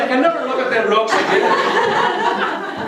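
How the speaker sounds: high, loud, laughing outburst; cracking up while scolding the author in jest; laughing exclaim. Real, not acted. Telling the author he spoiled the rocks, laughing loudly. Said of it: "I'm only joking".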